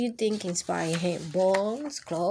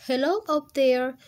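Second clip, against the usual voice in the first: louder call up into a tree; 6 semitones higher, 6 dB louder than usual. Acted. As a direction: call up to someone high in the branches. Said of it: "he called".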